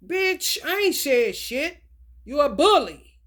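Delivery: loud, high, defiant schoolyard comeback; mock quarrel. Retelling a school fight, loud defiant snap. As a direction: snap back at the bully, loud.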